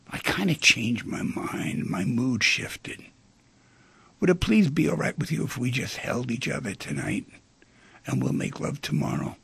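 soft, low, tender apology; tenderness. Acted. Woman asking her darling to just hold her tonight, tender. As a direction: gently let a darling down. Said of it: "Any tenderness would have solved it".

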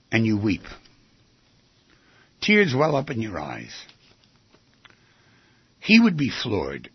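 usual speaking voice

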